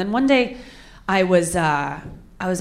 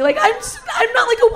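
imitating a tearful protest, very high; tearful panic; 14 semitones higher than usual. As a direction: stammer out a high, mock-crying denial. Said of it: "I started crying instantly".

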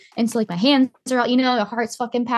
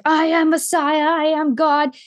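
mock screaming; 5 semitones higher, 3 dB louder, slower than usual. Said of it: "started screaming".

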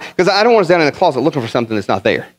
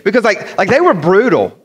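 usual speaking voice

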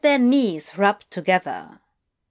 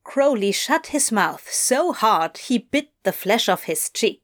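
plain reading voice

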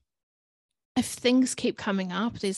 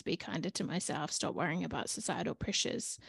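soft reading of her goal; 3 semitones lower, 8 dB quieter than usual. Shown as a demonstration. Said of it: "my first step to my goal was to journal out".

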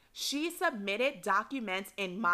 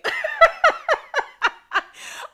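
loud squealing laugh; caught-out shriek of laughter; high burst of laughter; 10 dB louder than usual. Real, not acted. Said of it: "I almost said her name".

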